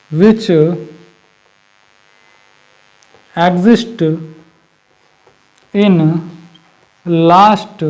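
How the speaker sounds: each word alone with long gaps; slow dictation. Shown as a demonstration. Teacher dictating the last words of the definition, one by one. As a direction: spell it out word by word as you write. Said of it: "लिखवा देता हूँ".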